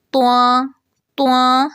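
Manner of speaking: تواں with rounded lips and a nasal ending; nasal mistake. Shown as a demonstration. Teacher imitating the rounded, nasal error. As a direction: end تواں in the nose. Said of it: "آواز ناک میں لے جاتے ہیں".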